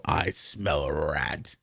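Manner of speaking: low, slow mock-sinister line; mock suspicious. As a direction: sniff out the trick, dragged out low. Mock suspicion while streaming.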